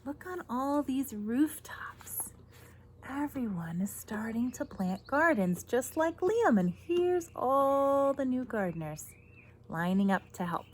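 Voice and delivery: ordinary delivery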